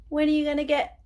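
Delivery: high, loud taunting call; teasing. Acted. Boy taunting classmates before being cut off, loud. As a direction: sing out the jab.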